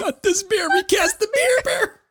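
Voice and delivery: high, squeaky, mock-crying bear plea; mock wail. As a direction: wail it way up high, half laughing. Mock wail about recasting bear.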